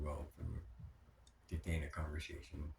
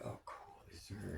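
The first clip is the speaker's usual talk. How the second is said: soft unvoiced whisper; 7 dB quieter than usual. Husband recalling a text arriving, whispered. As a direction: breathe it out under the breath.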